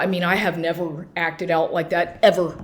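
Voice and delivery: ordinary talk, nothing marked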